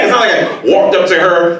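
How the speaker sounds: ordinary talk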